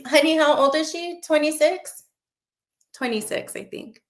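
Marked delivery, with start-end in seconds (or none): 0.1-2.0 raised, higher-pitched questioning call; higher voice, asking honey for confirmation; calling to partner. Real, not acted. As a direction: check with someone off to the side, ending on a rising question. Asks partner off to the side.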